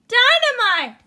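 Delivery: high, loud cry of alarm; alarmed exclamation. Acted. Penny spots the agent lighting the fuse, crying out high and loud. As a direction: blurt it out in shock. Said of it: "she exclaimed".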